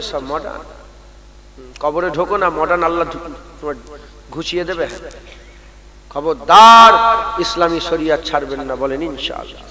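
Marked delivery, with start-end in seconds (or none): 6.1-9.6 a sudden loud warning to the crowd; loud sharp warning; stern warning shout. Real, not acted. Preacher warns the congregation.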